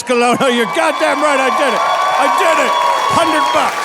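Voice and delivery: loud boastful declaration; triumphant boasting yell; proud shout. Real, not acted. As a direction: shout it out to the crowd with pride. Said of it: "proud triumphant declaration to crowd".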